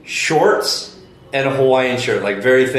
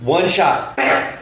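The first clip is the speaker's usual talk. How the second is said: normal talking voice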